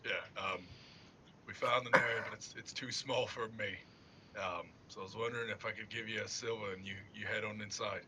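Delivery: low, quiet sweet-talking; sly persuading. Acted. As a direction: coax him in softly. Character coaxes Gub quietly.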